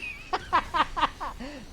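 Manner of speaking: helpless wheezy giggling; real laughter. Real, not acted. Player amused by the accidental accent, laughing. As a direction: laugh at your own slip.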